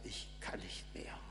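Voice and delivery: whispered, low 'I give up'; spent defiant child. Acted. Defiant child who threw itself on the ground, worn-out whisper. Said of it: "wie einem trotzigen Kind, das sich auf die Erde wirft und schreit".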